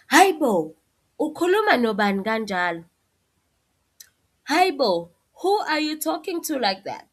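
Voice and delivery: upset parent, rising indignation; upset, exaggerating. Shown as a demonstration. Upset parent scolding a rude child, loud and high. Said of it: "if they were upset ... exaggerating".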